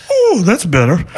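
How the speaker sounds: high, far-off relieved sigh of a puppet; relieved Snuffy. Retold or imitated. Teller voicing Snuffy after throwing up, high and relieved. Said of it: "and Snuffy goes".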